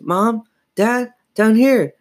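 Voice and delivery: high, urgent calling out. A narrator's reading. Girl calling upstairs to her parents, calling out. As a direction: call to someone upstairs for help. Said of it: "She called up to them".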